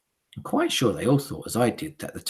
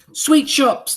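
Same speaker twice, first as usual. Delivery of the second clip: witches crying out; 10 dB louder than usual. Acted. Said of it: "they cried".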